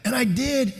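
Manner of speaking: usual speaking voice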